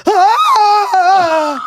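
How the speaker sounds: a sudden high yelp of ecstasy; mock orgasm scream. Recreating the lady's scream for the hosts, high yelp. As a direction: belt out a short ecstatic cry. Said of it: "can you recreate what it sounded like".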